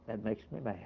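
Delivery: low, soft echo for effect; hushed repetition. Retold or imitated. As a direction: drop right down and let it sink in. Repeats God's words for effect.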